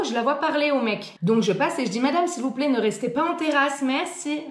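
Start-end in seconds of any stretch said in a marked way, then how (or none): 2.0-4.4 higher, polite service voice. Retold or imitated. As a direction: ask nicely like a polite waitress. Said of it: "C'est ma voix de poli".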